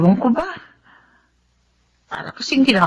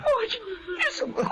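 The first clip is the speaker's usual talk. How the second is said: slow, much softer sobbing cry; broken sob; 14 dB quieter, much slower than usual. Acted. Grandmother hearing of surgery, sobbing softly. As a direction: let it collapse into tears. Hears baby needs heart surgery.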